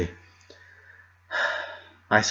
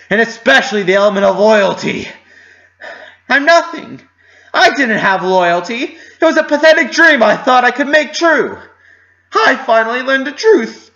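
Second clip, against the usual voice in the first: anguished outburst; 10 semitones higher, 7 dB louder than usual. Acted. Narrator's anguished self-blame outburst.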